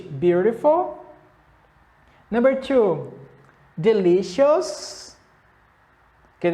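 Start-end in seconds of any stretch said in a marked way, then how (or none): 0.0-1.0 beautiful said slowly with a rising end; slow drill word. Shown as a demonstration. Teacher drills the class, slow rising model. 3.8-5.2 beautiful said slowly with a rising end; slow drill word. Shown as a demonstration. Teacher drills the class, slow rising model.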